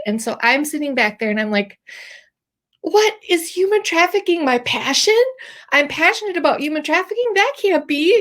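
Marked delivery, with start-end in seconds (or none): 2.8-8.2 high-pitched, astonished disbelief; incredulous self-questioning. Retold or imitated. Asking herself if this is really her passion, incredulous.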